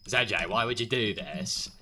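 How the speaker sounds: over-the-top whiny begging; Gecko impression. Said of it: "It's my Gecko impression".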